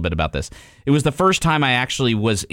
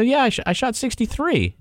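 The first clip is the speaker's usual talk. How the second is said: proud reveal; 13 semitones higher than usual. Retold or imitated. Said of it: "I go".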